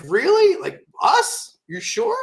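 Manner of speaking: high squeaky questions in disbelief; incredulous. Retold or imitated. Retelling the Hulk Hogan email, high incredulous questions. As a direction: pitch every question way up, can't believe it. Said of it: "I'm like".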